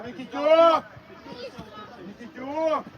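very loud shout; yelling from the sidelines. Real, not acted. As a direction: bellow the name across the pitch. Coach yelling at player.

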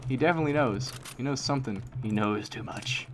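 usual speaking voice

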